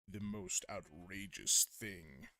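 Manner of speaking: slow, low villain voice; lightly voiced evil villain. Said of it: "like an evil villain kind of voice".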